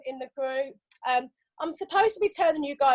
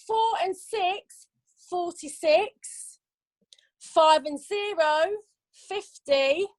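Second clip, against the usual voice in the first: slow sing-song number calling; bingo caller; 3 semitones higher, much slower than usual. Acted. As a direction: call each number drawn out.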